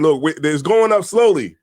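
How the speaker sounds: normal talking voice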